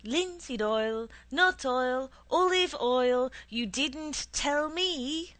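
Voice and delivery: loud, high, slow complaint; angry. A narrator's reading. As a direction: throw each word back angrily. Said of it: "I said angrily".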